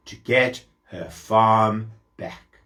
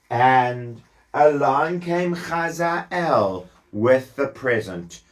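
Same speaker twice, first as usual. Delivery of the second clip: plain reading voice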